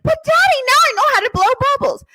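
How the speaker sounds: put-on very high, loud kid's plea; protesting child. Child pleading her case to daddy, protesting. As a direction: imitate a child piping up in protest.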